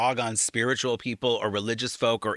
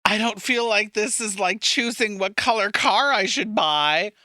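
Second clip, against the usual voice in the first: high mocking voice; 8 semitones higher, 4 dB louder than usual.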